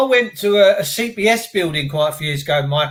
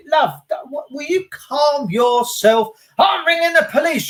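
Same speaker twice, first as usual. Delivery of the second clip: retold shout; 9 semitones higher than usual. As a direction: yell at her to calm down. Chased down alley, shouting back.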